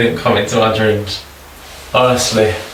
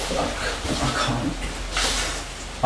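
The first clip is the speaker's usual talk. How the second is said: stunned whisper; 13 dB quieter, much slower than usual. Real, not acted. Overwhelmed in dark vicar hunt.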